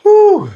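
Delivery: very high, loud blown-out breath; exhausted whew. Real, not acted. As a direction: exhale hard, spent from the round. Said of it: "I was so tired from that round".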